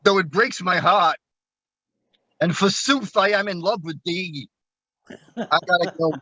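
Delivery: Brooklyn gangster attempting Shakespearean romance; harsh Brooklyn accent. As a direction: play a Brooklyn tough guy going lightly lofty. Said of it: "in his harsh kind of Brooklyn accent".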